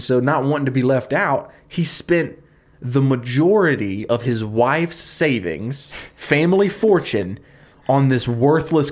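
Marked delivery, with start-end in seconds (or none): none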